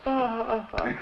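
high, forced "ah, ah, ah" on command; forced laugh. Retold or imitated. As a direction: fake a laugh because you're ordered to. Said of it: "I said".

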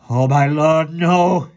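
very high, loud anguished cry; high loud sobbing wail. A narrator's reading. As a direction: wail in despair. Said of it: "he sobbed".

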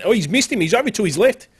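loud, high caster excitement; excited shout. Real, not acted. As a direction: burst out at the miss. Caster reacts to a miss.

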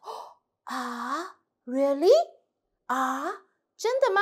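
disbelieving a secret; doubtful surprise. Shown as a demonstration. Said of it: "confused with doubt".

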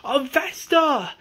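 high, desperate calling out for Vesta; yelling for Vesta across the chaos; frantic yell. Retold or imitated. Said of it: "John is going crazy".

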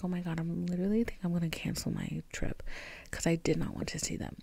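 hushed whisper of a panicked thought; whispered inner thought. Retold or imitated. Whispering to herself about cancelling the trip, hushed. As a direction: whisper it like a secret worry. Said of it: "like, I was thinking".

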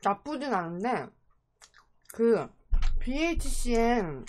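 chewing while talking; speaking between bites; mouth partly full. Real, not acted. Seen 1: Eats before, seems chewing early on; later talks holding chicken.

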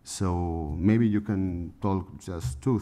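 ordinary talk, nothing marked

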